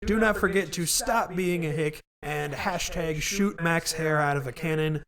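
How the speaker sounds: ordinary talk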